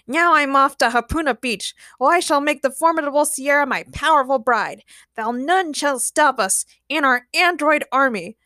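villain proclamation. A narrator's reading. Villain departs to claim his bride, proclaiming. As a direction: proclaim your evil plan grandly.

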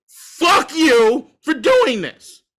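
slow emphatic curse. Real, not acted. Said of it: "And can still say".

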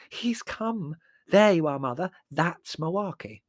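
high, thrilled exclamation; delighted. A narrator's reading. Margot telling Mother the spirit has come, delighted.

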